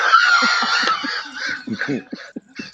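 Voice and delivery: high hysterical laugh. Real, not acted. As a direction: burst into a shrieking laugh. Bursts out laughing.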